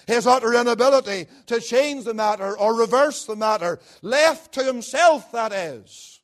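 loud, rising preacher's fervour; full-force preaching. Acted. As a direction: thunder the verdict on every man.

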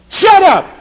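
explosive high shout; full-force rebuke; screamed command. Acted. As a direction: scream it at the waves. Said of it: "And he screamed at them".

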